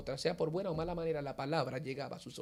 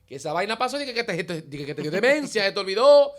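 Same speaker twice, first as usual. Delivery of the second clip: loud and higher, a burst of astonished disbelief; shocked disbelief; 5 semitones higher, 13 dB louder than usual. Retold or imitated. Son stunned that his mother fell, loud disbelief. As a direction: blurt it out, stunned and loud, calling on God. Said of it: "Digo yo".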